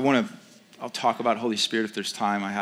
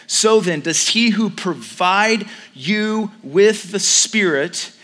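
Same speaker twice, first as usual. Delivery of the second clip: fervent, high, loud preaching; 7 semitones higher, 7 dB louder, slower than usual. Acted. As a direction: preach it out with full conviction. Pastor preaching to congregation.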